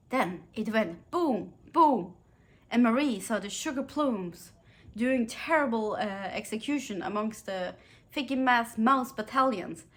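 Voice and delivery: lively storybook narration of a toy battle; animated story reading. Acted. Vlogger reads funny Nutcracker battle passage to viewers, animated storytelling. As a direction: read the battle scene with gusto, like to children.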